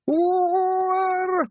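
long, very high, loud "ooh". A narrator's reading. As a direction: let out a high, sustained ooh from afar. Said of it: "Somewhere a voice went".

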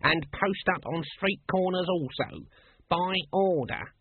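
high, officious announcement; loud proclaiming. A narrator's reading. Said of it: "said the guard loudly".

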